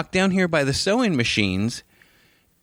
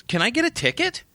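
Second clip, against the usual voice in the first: entitled lady; 13 semitones higher than usual. Retold or imitated.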